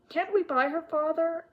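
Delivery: high-pitched pleading question; imploring young girl; begging. A narrator's reading. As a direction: beg your father in a small high voice. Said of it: "begged Felicity".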